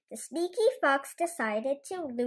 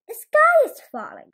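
high, loud announcement; announced; 13 semitones higher, 12 dB louder than usual. Acted. Goosey Lucy announcing to Foxy Loxy, high and loud. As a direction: proclaim it in alarm. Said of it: "Goosey Lucy announced".

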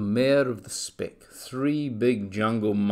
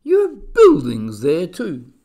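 loud, higher-pitched incredulous question; gasped astonishment; 5 semitones higher, 11 dB louder than usual. A narrator's reading. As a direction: blurt it out, amazed. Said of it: "gasped Horton".

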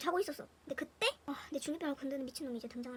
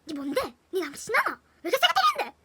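louder, rising to a high laughing yell; imitating her furious teen self; mock fury breaking into laughter; angry outburst; 5 semitones higher, 10 dB louder than usual. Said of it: "연병 천불 다 떨었을 거야".

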